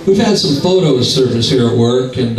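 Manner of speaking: ordinary talk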